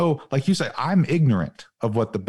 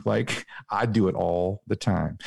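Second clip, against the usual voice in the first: ordinary delivery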